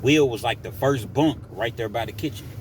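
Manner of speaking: ordinary delivery, nothing marked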